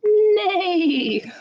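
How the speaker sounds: high whinnying neigh; whinny it like a horse. Shown as a demonstration. Librarian asking kids to neigh along, high whinny. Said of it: "What's a horse say?".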